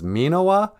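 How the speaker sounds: long first vowel in "Minowa"; spelling pronunciation. Shown as a demonstration. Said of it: "looked up the spelling".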